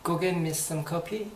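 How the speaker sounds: slow polite request. Shown as a demonstration. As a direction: ask for the coffee politely, slowly. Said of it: "정중하게 얘기를 하면".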